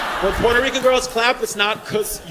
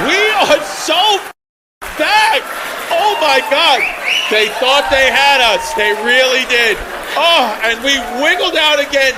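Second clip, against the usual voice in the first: loud, raised mock victory cry; mock jubilant; 4 semitones higher, 5 dB louder than usual. Comic gloats to the crowd over a comeback, mock jubilant. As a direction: crow it loudly like a champion, hammed up.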